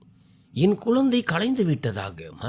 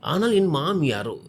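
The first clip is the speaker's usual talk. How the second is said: ordinary delivery, nothing marked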